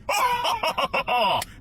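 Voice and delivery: high, loud, manic acted cackle; hyena laugh. Runner laughing down the road at night, hyena laugh. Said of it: "laughing like a fucking hyena".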